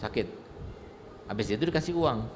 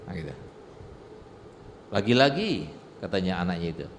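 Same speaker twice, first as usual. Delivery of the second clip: ordinary talk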